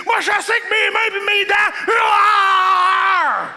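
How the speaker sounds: loud brag ending in a roar; macho roar. Retold or imitated. Old-time man bragging about bare hands, roaring. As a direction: roar like a caveman. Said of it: "l'homme qui faisait comme".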